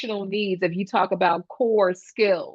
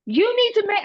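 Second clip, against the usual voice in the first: high, insistent mock kid voice; demanding child; 13 semitones higher, 4 dB louder than usual. Child orders the adult to force an apology, shrilly insistent. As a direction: imitate a child insisting, pitched way up.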